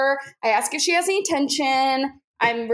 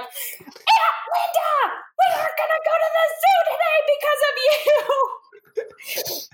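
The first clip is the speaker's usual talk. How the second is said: put-on annoying Disney kids; 14 semitones higher than usual. Kids blaming the therapist over the zoo, mock whining. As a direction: whine it like an overacting child star. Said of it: "annoying Disney Channel kids".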